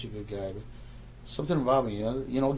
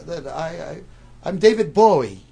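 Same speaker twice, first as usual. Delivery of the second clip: polite British voice; 5 semitones higher, 5 dB louder than usual. Retold or imitated. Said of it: "he goes".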